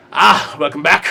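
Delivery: put-on dwarf greeting in a light Australian accent. Dwarf smith greets the returning hero, Australian accent. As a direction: welcome them back, Aussie-style. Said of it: "You suddenly got very Australian".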